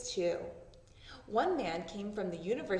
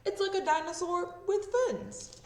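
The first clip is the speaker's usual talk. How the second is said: explaining man; 9 semitones higher, 4 dB louder than usual. Acted.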